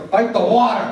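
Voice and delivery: high, loud chant of fight the water; rising shout. Retold or imitated. Counselor chanting to bored campers, shouting. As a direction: build the chant to a yell. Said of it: "Yeah, fight the water".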